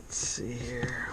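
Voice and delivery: slow, breathy mutter under his breath; whispering to himself. Real, not acted. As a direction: whisper it to yourself, slowly. Muttering to himself while working.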